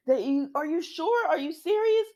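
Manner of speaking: high-pitched incredulous questioning; mock disbelief. Steffi questions Finn about Sheila being alive, mock disbelief. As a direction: imitate someone startled, pitch climbing high.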